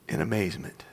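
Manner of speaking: breathy, whispered amazement; awed hush. A narrator's reading. Narrator tells of servant seeing the hills, awed hush. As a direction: drop to a near-whisper of wonder.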